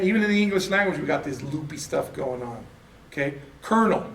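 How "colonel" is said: colonel said as kernel with an R sound. Shown as a demonstration. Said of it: "Colonel has no R in it".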